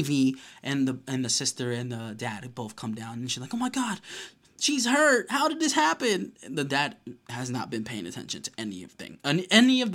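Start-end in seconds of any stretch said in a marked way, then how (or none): 3.5-6.3 high-pitched imitation of panicked shock; hammed-up worried squeal; mock alarm. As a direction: cry out in mock horror. Retold sister finding girl hurt.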